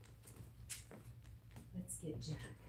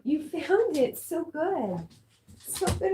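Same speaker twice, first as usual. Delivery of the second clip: high, loud gushing praise for the dog; overjoyed, much higher and louder; excited praise; 11 semitones higher, 7 dB louder, slower than usual. Real, not acted. Dog found its toy.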